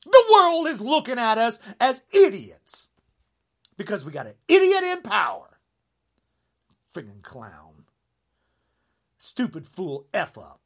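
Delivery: ordinary delivery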